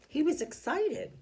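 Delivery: excited. Shown as a demonstration. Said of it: "if you look at chapter two".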